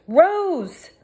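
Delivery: desperate high shout of her name; calling out. A narrator's reading. Girl shouting at her unconscious friend. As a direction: cry her name to wake her.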